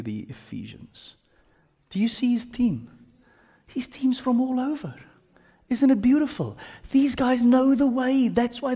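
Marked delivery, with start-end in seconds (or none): 3.7-4.9 hushed high whisper. Real, not acted. Teacher whispering to class about Paul's diverse team. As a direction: breathe it in awe.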